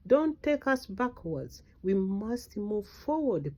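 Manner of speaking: normal talking voice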